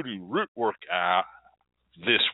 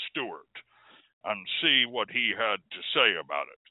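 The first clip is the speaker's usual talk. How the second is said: lower, grave field-reporter voice; Phil Patchy Fogg; 4 semitones lower than usual. Acted. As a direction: report it like a serious newsman. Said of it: "We now turn you over live to Phil Patchy Fogg".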